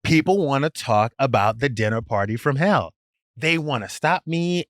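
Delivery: ordinary delivery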